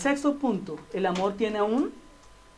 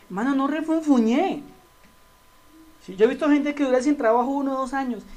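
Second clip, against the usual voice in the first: normal talking voice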